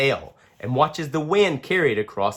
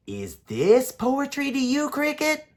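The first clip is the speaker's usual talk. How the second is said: put-on higher, eager child voice; bright curious kid asking; young boy Daniel; 12 semitones higher than usual. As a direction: ask like a curious little boy.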